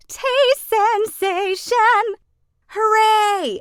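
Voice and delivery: very high, loud, slow cheer; cheering crowd. Acted. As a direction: yell it out in triumph. Everyone cheers the food.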